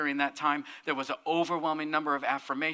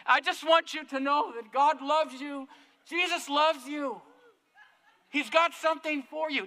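lightly voiced prayer warrior pleading through the door; earnest loving message; 8 semitones higher than usual. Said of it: "She's talking through the mail slot".

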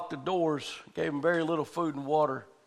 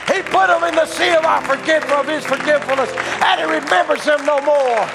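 loud, high, slow preacher's cry; rising revival preaching; preaching at full force; 13 semitones higher, 10 dB louder, slower than usual. Acted. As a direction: proclaim it to the congregation.

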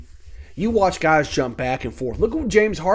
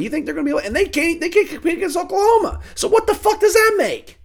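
building to a yell. Real, not acted. Ranting to viewers that Texas can't compete, climbing to a yell. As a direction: wind yourself up until you're yelling.

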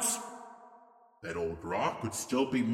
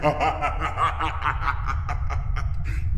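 gleeful villain cackle; cackling over the children; mocking laugh. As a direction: laugh at the chant. Trickster mocks the chant.

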